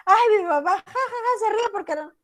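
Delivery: high voice with a fake "ja, ja, ja"; mocking laugh. Child mocks the mother's laughing emoji, hammed-up laugh. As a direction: play the kid laughing it off. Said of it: "la carita que se está muriendo de risa".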